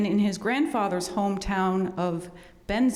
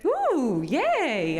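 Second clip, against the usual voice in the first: excited; 7 semitones higher, 3 dB louder than usual. Real, not acted. Audience raising hands for library cards.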